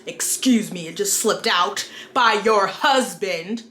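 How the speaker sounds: outraged prudish character, hammed up; hammy stage actor. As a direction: overplay a scandalized conservative. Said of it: "thank you if you watched through my terrible skit".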